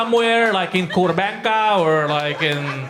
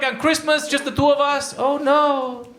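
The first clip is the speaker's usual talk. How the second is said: higher, lightly mocking dismay; a hint of a whiny couple, pitched up; 7 semitones higher, slower than usual. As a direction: feign horror at being left alone. Mimics the couple's Christmas reaction.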